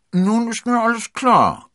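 loud, high character voice while eating; talking between bites. A narrator's reading. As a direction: announce it between bites of chicken. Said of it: "zwischen zwei Bissen gebratenem Hähnchen".

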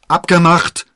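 high, very loud shout; calling out. A narrator's reading. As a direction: shout your agreement as you dash off. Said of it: "rief D'Artagnan". (laut gerufen)

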